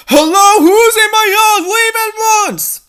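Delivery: high, loud yell from the window. Acted. Dee Dee yells at someone hiding in her yard. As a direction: shout out to scare off the intruder. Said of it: "as she kept yelling".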